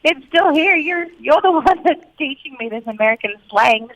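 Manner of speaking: high-pitched playful protest; acted comeback to a teasing spouse; mock-defensive retort. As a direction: answer back in mock indignation.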